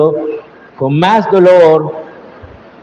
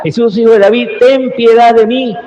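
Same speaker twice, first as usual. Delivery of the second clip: crying out; 5 semitones higher than usual. Retold or imitated. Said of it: "él empieza a gritar".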